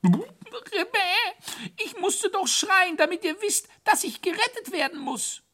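very high, whiny falsetto wail; wailing hare. A narrator's reading. Soaked hare justifying his screams to his rescuers, wailing. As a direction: bawl it in a squeaky high voice. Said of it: "bevor er heulte".

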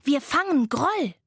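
high, loud rallying cry; called out. A narrator's reading. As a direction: announce the hunt boldly. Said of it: "rief Laila". (kämpferisch und hoch gerufen)

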